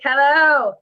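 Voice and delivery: loud, stretched hello; mock phone greeting. Joking about phones getting huge, loud mock hello. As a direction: call hello into an imagined giant phone. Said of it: "Pretty soon I'll be like".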